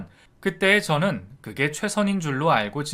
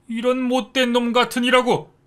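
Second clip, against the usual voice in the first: 같으니라고 yelled high and loud with a falling end; furious father; 10 semitones higher, 6 dB louder than usual. A narrator's reading. Father roaring at his son again, 같으니라고 high, loud, falling. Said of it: "아버지가 아주 많이 화가 나셨답니다".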